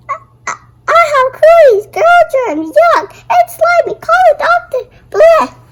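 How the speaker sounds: high, loud, rushed hammed-up disgust; mock panic. Boy kissed for good luck, high hammed-up panic. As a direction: freak out over girl germs.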